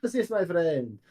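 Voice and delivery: high, delighted hero greeting; swooping, hearty recognition; Demos greeting. Acted. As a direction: greet an acquaintance with theatrical joy. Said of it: "he goes".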